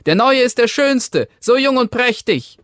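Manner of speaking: very high, loud delighted cry; children calling out. A narrator's reading. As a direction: cry out in excited child voices. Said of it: "riefen sie".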